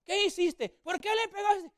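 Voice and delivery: high, loud demand; angry grandfather. Retold or imitated. Grandfather confronting the boy on the sofa, high and loud. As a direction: bark the question at the boy.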